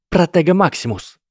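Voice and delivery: high, loud incantation; spell shout. Acted. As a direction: cry out the spell. Susan casts a shield spell.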